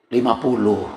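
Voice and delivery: low, soft short answer; hushed, low-pitched deadpan reply; understated fib. Retold or imitated. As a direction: drop the price quietly, as a punchline. Husband's sheepish lie about price.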